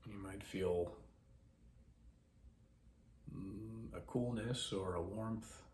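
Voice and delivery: soft, measured voice with long pauses; guided meditation. Acted. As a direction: guide listeners gently into breath awareness.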